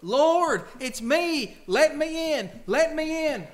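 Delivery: high, loud desperate knocking plea; pleading cry. Acted. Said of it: "beating on the door".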